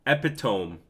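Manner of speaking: usual speaking voice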